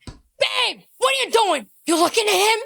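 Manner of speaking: high, loud mock accusation; hammed-up jealous outburst; jealous boyfriend. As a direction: confront your babe in an overwrought panic. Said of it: "He goes in the gym".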